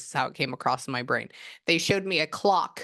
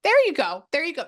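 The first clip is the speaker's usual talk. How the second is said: delighted approval; 11 semitones higher, 7 dB louder than usual. Real, not acted. Delighted by the editing excuse.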